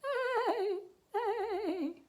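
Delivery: high, neighing wails; acted crying. A narrator's reading. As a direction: cry like a horse in a children's story. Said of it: "they both sat there crying".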